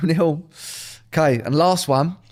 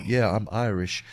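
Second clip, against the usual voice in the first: lightly mocked American, low and softer; imitated American; 7 semitones lower, 5 dB quieter, slower than usual. Comic showing Americans claiming Irish roots, imitated American. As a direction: drop the pitch for a casual American claim. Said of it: "they'll go like".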